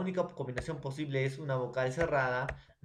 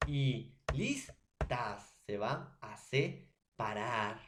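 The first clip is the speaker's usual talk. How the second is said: slow syllable-split reading; much slower than usual. Shown as a demonstration. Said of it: "Y, ni".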